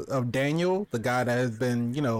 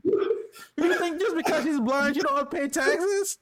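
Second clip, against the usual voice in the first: incredulous disbelief; 11 semitones higher than usual. Real, not acted. Incredulous at the tax joke.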